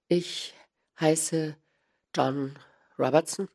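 halting, a pause after each word; hesitant. A narrator's reading. Small man giving Holmes a false name, hesitating. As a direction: stall while giving the name. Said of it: "Der Bursche zögerte".

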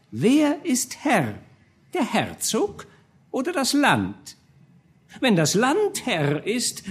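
high, reedy courtier pressing his lord; a serpent-tongued adviser, high and insistent; scheming chancellor. A narrator's reading. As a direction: chancellor urging the duke to sign the new law, high and wheedling. Chancellor voice held.

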